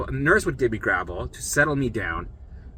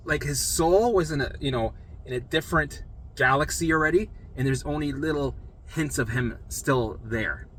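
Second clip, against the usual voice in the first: normal talking voice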